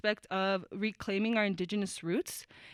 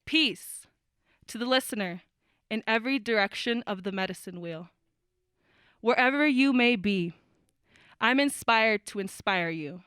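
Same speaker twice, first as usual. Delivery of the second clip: spoken word poem; 3 dB louder than usual. Acted.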